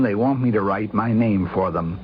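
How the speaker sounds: normal talking voice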